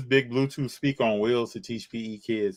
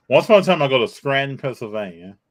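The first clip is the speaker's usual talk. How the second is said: higher, louder story opening; louder storytelling restart; 4 semitones higher, 8 dB louder than usual. Acted. Man restarting his Scranton story, louder story narration. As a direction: relaunch the tale with gusto.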